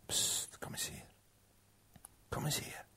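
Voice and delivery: barely audible beckoning whisper; whispered luring. Acted. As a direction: whisper as a tempting serpent. Slang lokt de vrouw. (heel zacht fluisterend lokken)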